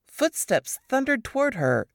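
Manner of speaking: usual speaking voice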